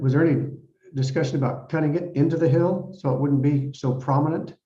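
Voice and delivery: normal talking voice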